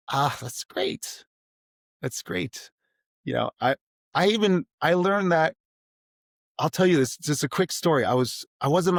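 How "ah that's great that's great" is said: calm teacher reply. Retold or imitated. Teacher answering a struggling student, slow and soothing. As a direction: ease the tension, slowly and gently. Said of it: "lower the temperature a bit".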